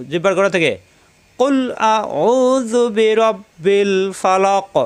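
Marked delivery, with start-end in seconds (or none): 1.4-4.7 slow, higher melodic tilawat with long vowels; correct recitation. Shown as a demonstration. Teacher modeling the correct recitation for students. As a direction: chant কুল আউযু বিরাব্বিল ফালাক slowly and correctly.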